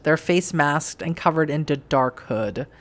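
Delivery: normal talking voice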